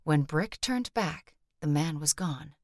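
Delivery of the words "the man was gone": usual speaking voice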